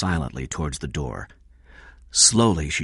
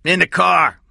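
high, loud shout through a door; 13 semitones higher, 9 dB louder than usual. A narrator's reading. Cy answers from the bathroom, shouting. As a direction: holler it from another room. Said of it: "Cy shouted from the bathroom".

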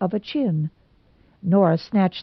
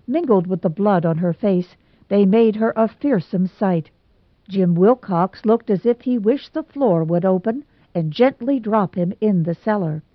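ordinary delivery, nothing marked

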